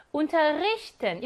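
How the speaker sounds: 운터리히튼 with the stress on 리; slow 운터리히튼, leaning on 리; stressed syllable shown. Shown as a demonstration. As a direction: demonstrate 운터리히튼 slowly, 리 stressed. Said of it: "여기에 강세 있어요".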